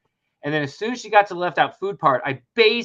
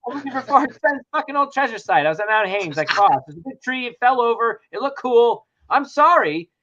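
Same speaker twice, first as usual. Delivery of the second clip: flustered babbling confession; 7 semitones higher, 3 dB louder than usual. Retold or imitated. Retold flustered confession to partner.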